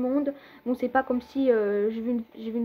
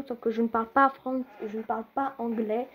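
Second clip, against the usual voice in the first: normal talking voice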